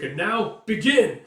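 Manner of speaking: loud, high, slow proclamation; announcing the start. Acted. Referee to both fighters, loud starting call. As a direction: launch the duel with a drawn-out call.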